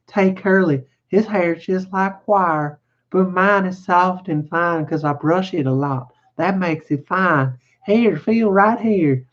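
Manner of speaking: high, slow woman's voice; self-satisfied ranch wife. Acted. Wife invites simple man to feel her hair, high and complacent. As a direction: play a wife lingering proudly over her hair.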